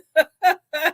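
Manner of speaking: tickled run of laughter; laughing. Real, not acted. Host after mimicking friend's accent, tickled laughter. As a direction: laugh at your own mimicry.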